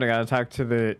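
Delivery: ordinary talk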